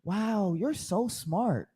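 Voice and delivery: slow, soft, sugary mock praise. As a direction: talk down to him like a child, drawn out. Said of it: "even the nice ones are condescending".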